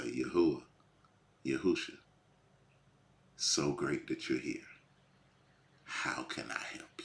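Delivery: calm soft greeting. Acted. Believer greeting Yahusha like a waiter, soft and calm. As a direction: gently offer help like a hushed attentive waiter.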